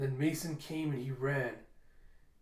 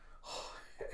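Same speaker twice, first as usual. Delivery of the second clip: heavy sigh; 11 dB quieter than usual. Real, not acted. After ominous email.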